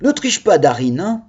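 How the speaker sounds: ordinary talk, nothing marked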